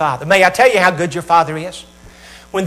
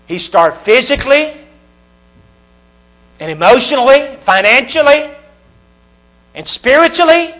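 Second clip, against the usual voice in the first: building emphasis; 6 semitones higher, 5 dB louder than usual. Real, not acted. Preacher building the list.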